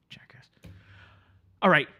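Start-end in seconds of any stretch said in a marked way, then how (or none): 0.1-0.5 very low, barely voiced mutter; muttered aside. Engineer insulting the departing client to himself, muttered. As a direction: let it drop to a whisper under the breath.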